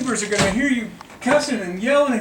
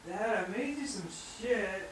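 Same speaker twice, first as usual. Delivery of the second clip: ordinary talk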